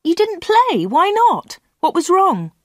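Anna hears Peter missed the match, surprised. A narrator's reading. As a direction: shoot up in disbelief. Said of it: "Anna was surprised".

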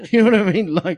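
normal talking voice

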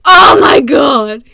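loud and slow, breaking into laughter; laughing outcry. Real, not acted. Vlogger cracking up at her own joke about God, laughing loudly. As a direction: cry out through the laugh. Laughing at God's imagined reply.

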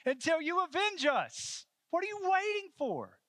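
very high, loud martyrs' plea; impassioned, strained shout of pleading; crying out. Acted. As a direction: cry to heaven at full voice. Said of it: "are crying out day and night".

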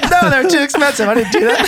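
laughing reply. Retold or imitated. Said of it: "It's like".

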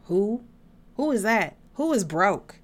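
loud, sassy who-is-that; teasing the broke listener, sassy mock confusion. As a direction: play dumb about anyone broke. Mock not knowing someone broke.

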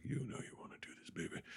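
fast, hushed mock-smooth whisper; seductive whisper. Han leaning in to Leia for a kiss, seductive whisper.

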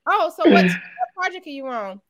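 high, loud chatty coworker; mock nosy colleague. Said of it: "Like, yeah".

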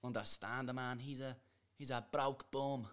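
put-on Dublin Irish accent; trash-talk in a Dublin brogue; measured, mocking Irish accent; Conor McGregor. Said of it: "I just did a Conor McGregor accent".

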